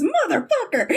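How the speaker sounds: "Motherfucker" blurted in a high laughing yelp. Retold or imitated. Kip bumping into Scott again, high laughing curse. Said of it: "the mirrored line of".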